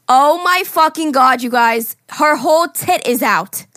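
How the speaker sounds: put-on Staten Island accent. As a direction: belt it out like a shocked Staten Island girl. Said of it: "so I'm gonna put on an accent".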